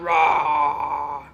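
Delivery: loud, low, long playful roar; wolf roar. Pretend. Mother roars as the wolf at the straw house, playful menace. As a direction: let out a pretend beastly roar. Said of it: "the wolf comes and says".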